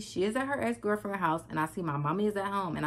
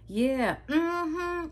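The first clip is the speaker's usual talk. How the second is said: high imitation of mom's odd agreement; talking weird; 11 semitones higher than usual. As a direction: mimic mom agreeing with nothing, pitched up. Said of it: "She just talking weird and she keeps saying".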